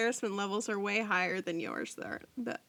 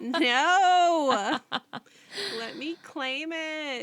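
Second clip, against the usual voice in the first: loud, higher, drawn-out mock wail; mock protest; 6 semitones higher, 9 dB louder, much slower than usual. Co-host wanting the praise for herself, mock wailing. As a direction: protest like a hammed-up sulking child.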